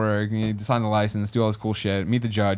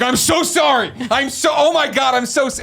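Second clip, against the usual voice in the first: much higher, slower apologetic driver voice; imitating the mortified driver, pitched way up; mock flustered apology; 13 semitones higher, 3 dB louder than usual. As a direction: gush out an embarrassed sorry in a high voice. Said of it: "He's like".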